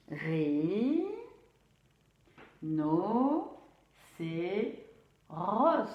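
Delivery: "Ri, no, c'est, ros" separated by pauses; syllable by syllable. Shown as a demonstration. Teacher helping pupils find the sound R, syllable by syllable. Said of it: "pour bien redire les syllabes".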